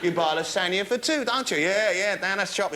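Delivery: normal talking voice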